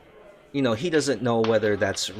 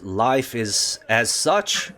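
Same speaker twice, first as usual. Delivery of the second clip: slow sage barkeep; slower than usual. Acted. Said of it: "He goes".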